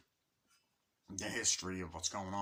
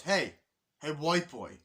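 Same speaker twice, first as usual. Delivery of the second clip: mock calling out; 6 semitones higher, 6 dB louder, slower than usual. Soldier calling out to Charlie Sheen.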